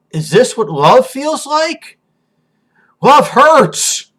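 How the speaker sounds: high, rising cry of painful wonder; anguished scream. Acted. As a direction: build to a loud, high, agonized outburst. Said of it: "And then she screams".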